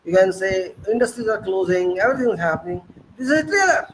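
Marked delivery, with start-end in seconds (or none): 3.2-3.9 sarcastic echo. Real, not acted.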